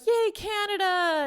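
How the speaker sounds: high, slow mock cheer. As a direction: cheer it out with wonder, pitch way up. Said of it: "he's like".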